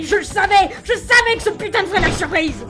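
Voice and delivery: high, heated outburst; vindicated rant. Acted. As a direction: blurt it with rising fury. Ranting at tailgater.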